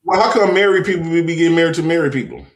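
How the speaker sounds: ordinary delivery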